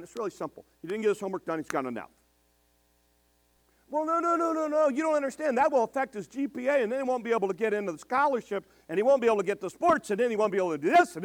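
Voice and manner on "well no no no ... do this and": higher, louder whiny parent, rushing the list of excuses; mock protesting parent. Parent arguing against a failing grade, whiny and rushed. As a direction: imitate a parent pleading for the child, piling up objections.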